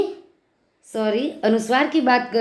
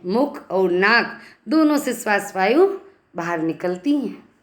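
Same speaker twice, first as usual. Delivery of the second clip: ordinary talk, nothing marked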